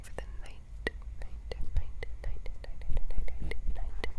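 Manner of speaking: asmr trigger words. Acted.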